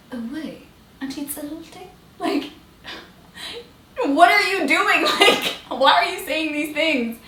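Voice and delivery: mock shocked parents, high and laughing; half-laughing disbelief from parents. Parents caught off guard, laughing in disbelief.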